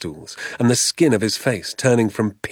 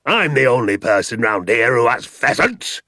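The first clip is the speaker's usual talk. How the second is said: louder, blustering shout; angry landowner; 2 semitones higher, 4 dB louder than usual. A narrator's reading. Mister Hazel ranting at the father, blustering and loud. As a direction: rant as if you own the whole valley. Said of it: "yelled Mister Hazel".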